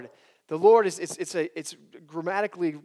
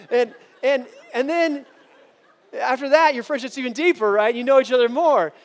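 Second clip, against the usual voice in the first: higher and louder, rising fervour; building excitement; 6 semitones higher, 9 dB louder than usual. Real, not acted. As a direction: lift the congregation with rising energy.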